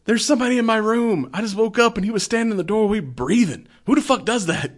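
put-on high, shaken child voice; frightened little boy telling; scared kid voice. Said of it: "he's like".

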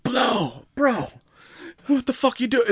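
put-on cussing like a sailor in an English accent; imitating English accent. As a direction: do Tom Holland's English accent. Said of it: "cussing like a sailor in this, like, thick English accent".

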